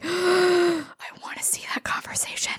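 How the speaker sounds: breathless excited whisper; hushed, voiceless, thrilled; awed whisper. Retold or imitated. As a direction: whisper it like an eager fan. Fan's awed reaction after gasp.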